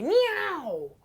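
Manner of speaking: high yowling meow; cat scream. Acted. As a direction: yowl up at the sky like a cat. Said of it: "Ocelote would scream up to the sky".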